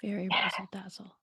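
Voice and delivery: hushed knowing whisper; soft whispered agreement; whispered echo. Real, not acted. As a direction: echo it under your breath. Echoed in whisper.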